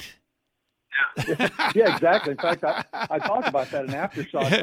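amused, laughing as you talk. Real, not acted. Amused reply to host's sarcasm.

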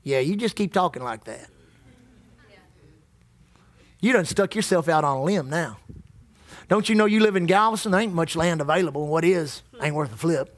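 low taunting tempter, mocking the pastor; taunting devil. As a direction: sneer like the devil in his ear. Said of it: "he's constantly saying".

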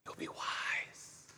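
whisper. Acted. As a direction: breathe it like a secret promise. Serpent whispering temptation.

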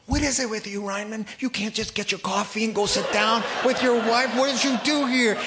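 a mock panic at himself, almost shouting; panicked self-scolding.

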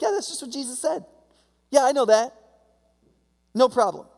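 mock-casual believer shrugging it off; breezy disciple. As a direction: toss it off like it's obvious. Imagined confident disciple reply.

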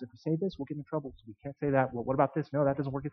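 acted huddle, very soft and secretive; quick private deliberation kept under the voice; hushed conference. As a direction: confer in low, hushed tones. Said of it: "they had this little conference".

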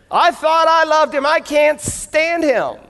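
mock fed-up venting; exasperated wife. Wife vents about her perfect husband, exasperated.

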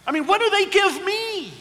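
mock complaint. Mock selfish parent complaint.

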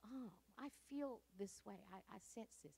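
soft, hushed musing; quiet inner voice. Retold or imitated. Believer noticing a faint inner feeling, softly. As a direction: murmur it like a private thought.

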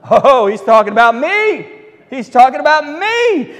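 loud, self-satisfied gloating; smug delight. Pretend. Haman gloating that the king means him. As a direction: crow it like he's the one. Haman imagining himself honored.

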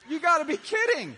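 incredulous laugh through the words; laughing disbelief. Real, not acted. Pastor laughing in disbelief at the clerk.

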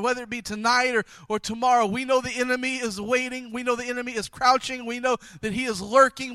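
a preacher's prayer building to full force; fervent closing prayer. Acted. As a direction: pray it out boldly, swelling at the peaks.

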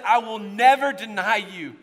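loud, high emphatic vow. Acted. Peter vowing loyalty to Jesus, emphatic. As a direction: declare it with full conviction. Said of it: "I emphatically said".

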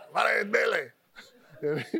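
slow, high, shouted preacher voice; Billy Dogood impression. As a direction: boom it out, drawn-out and high. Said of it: "He talked like this".

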